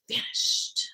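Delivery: slow, hushed fade-out; very soft, drawn-out whisper; vanishing whisper. A narrator's reading. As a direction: let the words fade away into a whisper. Narrator mimics the cat vanishing.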